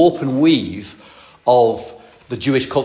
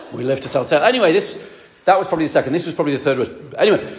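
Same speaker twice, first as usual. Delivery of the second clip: ordinary talk, nothing marked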